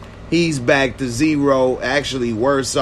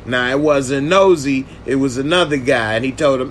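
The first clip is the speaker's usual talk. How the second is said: normal talking voice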